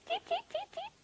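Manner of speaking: tiny, very high chick peeps; little chick voice, piping high; cheeping chick. A narrator's reading. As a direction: squeak out short baby-bird cheeps.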